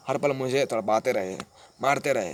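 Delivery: ordinary talk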